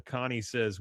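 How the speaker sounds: normal talking voice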